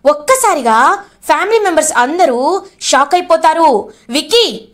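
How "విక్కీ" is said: ordinary delivery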